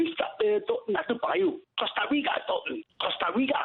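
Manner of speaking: hammed-up Geordie accent, pitched well up; lightly done Geordie accent; mock Geordie. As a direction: play a broad Geordie pundit, lightly. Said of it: "you're being Chris Waddle tonight".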